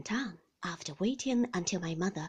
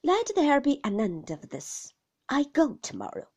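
higher, louder ultimatum; insistent sister-in-law; 6 semitones higher, 7 dB louder than usual. A narrator's reading. Sister threatening her brother she will go, loud and firm. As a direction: firmly declare the matter closed.

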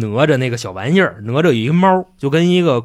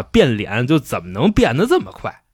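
ordinary delivery

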